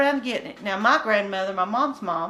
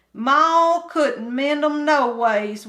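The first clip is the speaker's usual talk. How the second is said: put-on higher, louder hillbilly boy complaining; bored country boy; 5 semitones higher, 5 dB louder, slower than usual. Kim complaining to Winky about boredom, higher country boy voice. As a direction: whine it like a restless mountain kid.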